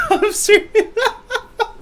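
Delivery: high voice cracking up around the words; laughing while insisting. Real, not acted. Game master trying to resume the game, laughing while insisting. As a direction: try to insist, but keep bursting into laughter.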